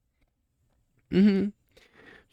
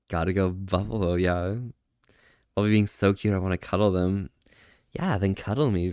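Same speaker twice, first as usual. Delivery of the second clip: flirty teasing; 7 semitones lower than usual. Acted. Flirty cuddle talk, low.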